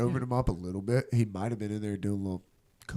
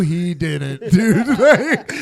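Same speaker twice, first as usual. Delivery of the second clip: shocked laughing; 6 semitones higher, 11 dB louder, much slower than usual. Retold or imitated. Bar reacting to gunshot.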